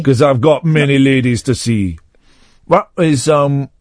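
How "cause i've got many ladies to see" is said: hammed-up Jamaican seducer's accent. Presenter propositioning co-host in jest, mock Jamaican accent. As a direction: put on a mock Jamaican chat-up.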